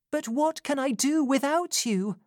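pleading Rose. A narrator's reading. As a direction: ask her not to go back.

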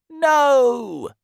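high, loud, long-held cry; storybook elephant's outraged shout; horrified refusal. Acted. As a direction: shout it out to forbid it. Elephant refuses crocodile's plan.

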